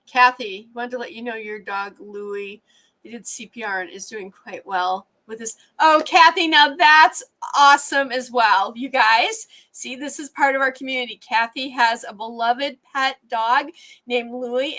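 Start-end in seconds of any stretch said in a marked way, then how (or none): 5.8-8.7 loud, raised, happy relief; real burst of gladness, louder and higher; delighted. Real, not acted. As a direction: cheer a friend's good news. Good news about a pet.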